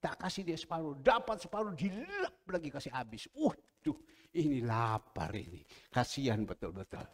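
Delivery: normal talking voice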